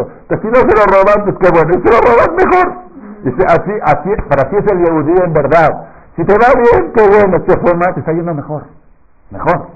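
soaring high and loud; exuberant preaching. Acted. Rabino celebra ante la congregación, muy alto y exaltado. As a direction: fire up the crowd with joy.

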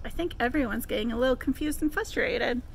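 ordinary delivery

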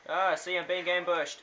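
loud frustrated outburst at dying; frustrated yelling. Real, not acted. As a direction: yell it out, outraged at the ambush. Ambushed and killed.

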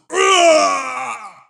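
sharp high shouted ah; battle cry. Acted. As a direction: let out a sudden war cry, high and loud. Warrior cries out in battle.

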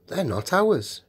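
mildly uneasy protest; doubtful. A narrator's reading. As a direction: object with a note of doubt. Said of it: "said Peter doubtfully".

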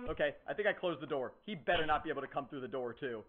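quiet, nervous hiding voice; hushed whisper. Real, not acted. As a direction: keep it low so he won't hear. Hiding from Baldi.

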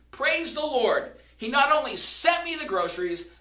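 high, loud delighted praise; happy praise. Retold or imitated. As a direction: bubble over with glee. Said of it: "jumping up and down, all the more happier".

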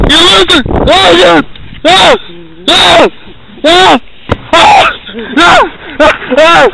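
high, loud shouts of pain; pained shouting. Real, not acted. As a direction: yell after a kick in the face. Kicked in the face on ride.

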